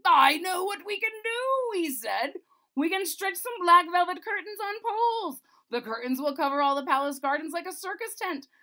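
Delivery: high, loud eager wizard voice; the wizard proudly offers his idea; royal wizard. A narrator's reading. Wizard character voice.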